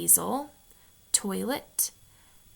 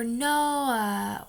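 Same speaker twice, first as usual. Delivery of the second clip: Utah trailing uh; 2 semitones higher, 5 dB louder than usual. Retold or imitated. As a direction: tack a Utah uh onto no. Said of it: "they'll do things like".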